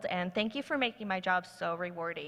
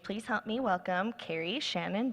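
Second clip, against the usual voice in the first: ordinary delivery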